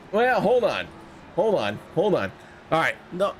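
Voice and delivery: breathless, trying to steady himself; catching breath. Real, not acted. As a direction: hold on, between quick breaths. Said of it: "Let me catch my breath".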